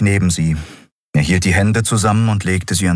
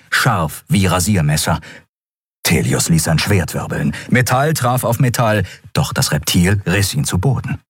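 plain reading voice

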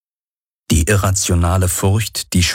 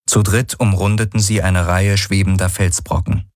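ordinary delivery

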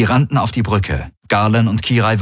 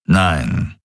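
low, grumbling refusal; grumbled; 4 semitones lower than usual. A narrator's reading. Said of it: "brummte Daraios". (tief gebrummt)

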